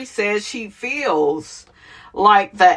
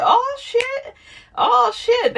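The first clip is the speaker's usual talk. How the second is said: high squealed surprise, much higher; shocked oh shit; slower than usual. Retold or imitated. Reacting to a reality show twist, high shocked squeal. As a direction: squeal it out in disbelief. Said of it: "I like what I saw. I said".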